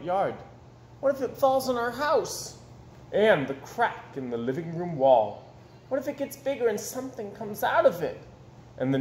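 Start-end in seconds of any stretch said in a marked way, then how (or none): 1.0-2.6 put-on higher, anxious child voice; worried little girl. The little girl frets to her mother, high and anxious. 5.9-8.2 put-on worried child. Wemberley's worried question.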